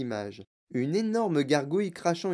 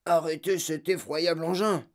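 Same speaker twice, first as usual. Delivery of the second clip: put-on voice, only a touch higher; mild yell; 3 semitones higher than usual. A narrator's reading. Father orders the dreadful lift stopped, mildly yelling. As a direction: demand a halt without much extra volume. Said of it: "hurla monsieur T V".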